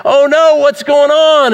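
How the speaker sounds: hammed-up alarmed "oh no"; freaking out. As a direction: voice the frightened church crying out. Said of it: "this church was freaking out and going".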